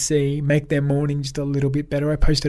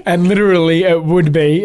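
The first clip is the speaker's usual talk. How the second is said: breathy burst of laughter, then louder words; real laugh spilling into speech; 5 semitones higher, 8 dB louder than usual. Real, not acted. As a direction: laugh at your own joke. Laughing at own joke.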